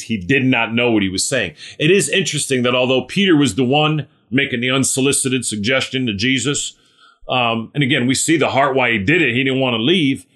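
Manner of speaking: usual speaking voice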